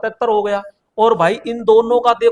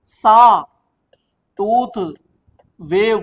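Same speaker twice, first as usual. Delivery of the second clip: Sawtooth drawn out very slowly and louder, each sound held; stretched-out teaching emphasis; 4 dB louder, much slower than usual. Shown as a demonstration. Teacher to students, stretching sawtooth out slow and loud. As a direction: spell out sawtooth slowly for the class so it sticks. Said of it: "क्या कहते हो English में".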